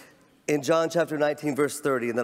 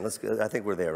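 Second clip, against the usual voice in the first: ordinary talk, nothing marked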